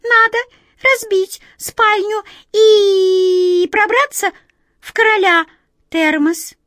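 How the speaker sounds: very high, loud squeals, one long held vowel; high cartoon mouse chorus; squeaking mice. A narrator's reading. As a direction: pipe the words in turn like mice. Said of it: "Мыши одна за другой торопливо пропищали".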